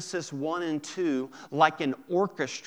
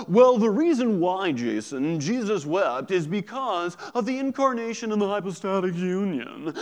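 mock know-it-all explaining, raised voice; loud opening, then a higher-pitched mock lecture; mock theologian; 4 semitones higher, 4 dB louder than usual. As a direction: lecture pompously, as a parody of over-explaining theology. Mocks an over-theological explanation.

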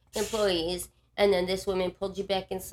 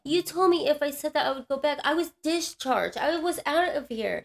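high-pitched, pleading outrage; re-enacting an exasperated accusation; indignant protest; 7 semitones higher than usual. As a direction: argue your case, voice pitched right up. Retold confrontation with intake lady.

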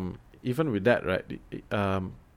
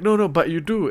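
higher, louder mock insisting; retold insistence; 7 semitones higher, 6 dB louder than usual. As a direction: imitate friends contradicting you, pitched up and loud. Retells friends insisting on British accent.

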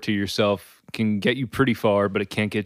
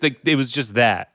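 usual speaking voice

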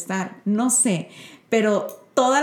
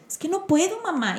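put-on whiny child; 9 semitones higher, 4 dB quieter than usual. Mother voices frustrated son.

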